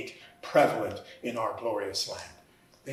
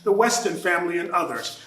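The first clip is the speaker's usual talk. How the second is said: normal talking voice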